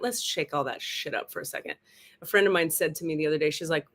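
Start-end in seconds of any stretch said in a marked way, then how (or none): 0.0-1.7 voicing the universe. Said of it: "the universe just went".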